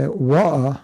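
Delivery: wa'a with a dropped t, glottal stop in the middle; London street accent. Shown as a demonstration. Teacher showing his class the Cockney way to say water. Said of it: "if you live on that street, you say".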